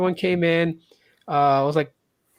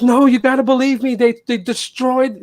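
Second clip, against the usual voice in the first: dramatic teacher; 7 semitones higher, 4 dB louder than usual. Retold or imitated. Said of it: "she was so dramatic about it".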